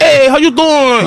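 put-on high, slow Dominican-accented greeting; imitated Dominican accent, pitched well up; thick Dominican accent. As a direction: draw it out in a mock Dominican accent. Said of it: "really thick Dominican accent".

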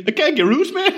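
high-pitched mock Geordie; Geordie accent. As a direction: call out in Geordie as if stuck in a lift. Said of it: "a Geordie stuck in a lift".

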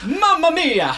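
high, exclaimed cartoon Italian accent; mock Italian plumber exclamation; Jared Leto. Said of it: "That is genuinely how he comes in".

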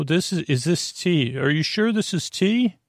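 normal talking voice

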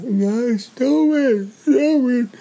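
high, loud, tearful plea to stop; little sister crying at the fighting boys, shrill and loud. Retold or imitated. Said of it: "she was crying".

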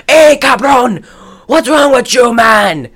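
mock Mexican accent. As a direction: bark it in a hammed-up Mexican accent.

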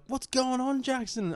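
high-pitched mock call-out; imitating friends' greeting. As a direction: pipe up high, imitating concerned mates. Retold friends calling out to him.

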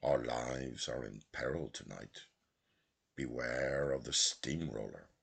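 a quiet, mock-solemn warning said with a joke in it; lightly joking warning; jocular. A narrator's reading. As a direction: tease him gently with a sham alarm. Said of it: "jocosely remarking".

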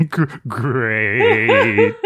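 choppy fan-blown voice; like speaking through a fan; talking into a fan. Pretend. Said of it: "It's so amazing".

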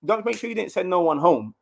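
rally the room like a captain; fired-up rallying speech. Retold or imitated. Player vowing to the gaffer that the squad stays, fired up.

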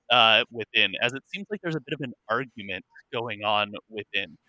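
ordinary delivery

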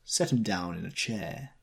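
plain reading voice

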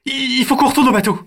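put-on high, loud, quick panicky kids; stammering urgency, then a pleading protest; urgent child voices. Siblings arguing whether to leave the dinosaur, high urgent voices.

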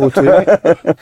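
mock his pet phrase while laughing; laughing tease. Real, not acted. Teasing his go-to question.

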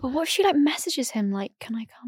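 unvoiced conspiratorial whisper. Real, not acted. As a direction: lean in and whisper the gossip. Conspiratorial hypothetical about friend.

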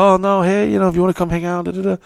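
high-pitched, breezy fake-friendly invitation; feigned interest. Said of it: "pretend like I'm slightly interested".

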